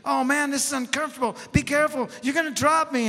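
acted-out alarmed whining; mock screaming. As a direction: play a panicky man being carried. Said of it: "he's screaming".

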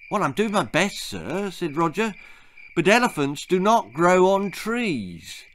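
put-on patient old guide. Guide answers politely.

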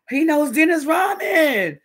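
high, loud and slow amazed cry; amazed gasp. Retold or imitated. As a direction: gasp it out, starstruck and thrilled.

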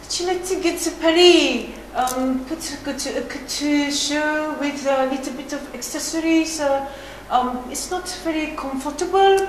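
halting put-on French accent; hesitant French-accented English. Shown as a demonstration. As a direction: improvise in a French accent, searching for words. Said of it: "French accent, shall we try that now?".

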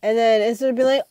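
ordinary talk

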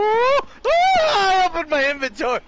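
high loud startled yell; panicked yell. Real, not acted. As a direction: yell as you fall. Screams while falling.

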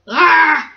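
short shouted "argh"; exasperated yell. Acted. Controller catches his own mistake, exasperated yell.

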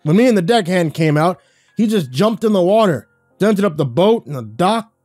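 loud, high furious rant; spitting mad. Acted. Dockmaster ranting about the drunk friend, spitting with rage. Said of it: "He was practically spitting".

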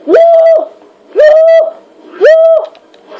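high, loud, thrilled whoops; excited whoops. Real, not acted. Excited whoops while fighting.

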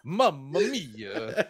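slow hammed-up Italian exclamation; stretched Mamma mia in a hammed-up Italian accent; mock Italian.

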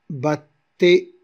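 "battait" split bat-tait, loud and deliberate; "battait" with no E after the T; syllables separated, silent ending. Shown as a demonstration. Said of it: "on n'a pas prononcé le E".

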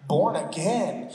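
"Born again" said slowly, each word separate; baffled Nicodemus, spaced out. Retold or imitated. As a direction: stretch "Born again" out at half speed. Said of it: "breathe in between the words born and again".

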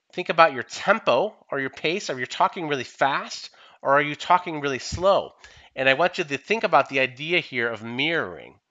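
usual speaking voice

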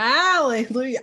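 loud, high burst of celebration; jubilant cheer. Real, not acted. Reverend crows over the Easter greeting, jubilant. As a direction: cry it out in gleeful triumph.

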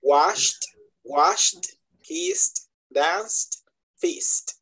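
Washed, kissed, danced each one syllable ending in T; final T pronunciation. Shown as a demonstration. Teacher drilling examples for students, washed, kissed, danced with final T. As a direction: list washed, kissed, danced separately, no E before the T. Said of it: "su pronunciación es una T al final".

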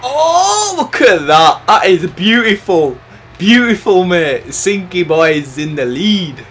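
high, very loud celebration; jubilant shouting. Real, not acted. Gamer taking the lead, shouting jubilantly. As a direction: yell it out, stretching the words.

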